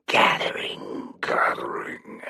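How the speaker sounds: gathering, gathering fading like an echo; echoing many-voiced whisper. Victor echoing his plan in a slow, eerie whisper. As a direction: let the gathering repeat and fade. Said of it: "his voice the sound of many".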